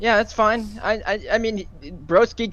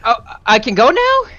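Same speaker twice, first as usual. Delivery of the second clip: sarcastic surprise; 7 semitones higher, 3 dB louder than usual. Real, not acted. Finally given the floor after rant.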